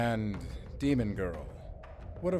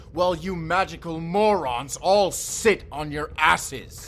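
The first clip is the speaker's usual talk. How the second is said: angry insult; 5 semitones higher, 9 dB louder than usual. Acted. Insulting the arrogant Fae.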